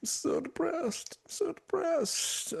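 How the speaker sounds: high, soft whiny mock moan; mocking moaner. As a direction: mimic a mopey coworker's whine. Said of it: "moans and groans all the time".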